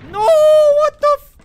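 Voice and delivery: shrieking high and loud in sudden shock; real panicked scream; startled scream. Real, not acted. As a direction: yelp it out, caught completely off guard. Sudden enemy ambush in game.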